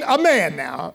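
ordinary talk, nothing marked

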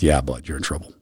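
gentle. Retold or imitated. Teacher answering a worried boy, quietly and kindly. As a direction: tell him the truth tenderly.

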